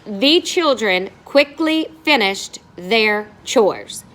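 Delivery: slow, deliberate reading of the sentence; slow read-aloud. Shown as a demonstration. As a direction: read each word slowly for the class. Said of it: "Let's diagram our sentence first".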